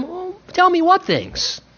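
high, slow gentle prompt; coaxing question. Retold or imitated. Jesus to the grieving disciples, gently coaxing.

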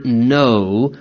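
"no" said low and firm; stress on no. Shown as a demonstration. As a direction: give the word "no" its weight. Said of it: "the emphasis there should be upon the word".

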